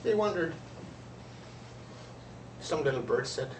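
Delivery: usual speaking voice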